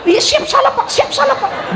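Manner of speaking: high mock squeak of a cowed subordinate; cowering yes-man. Husband answering his angry boss at the office, high mock meek squeak. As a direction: squeal yes sir like a scolded clerk.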